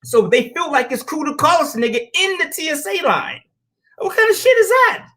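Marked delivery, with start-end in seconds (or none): 4.0-5.1 high, loud, indignant question; outraged. Real, not acted. To the listeners about the slur in the TSA line, high loud outrage.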